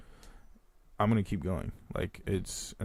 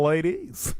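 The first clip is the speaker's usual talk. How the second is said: higher, lightly put-on cartoon voice; a quick cartoon-character impression; Mung Dal voice; 7 semitones higher, 4 dB louder than usual. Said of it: "That was my Mung Dal voice".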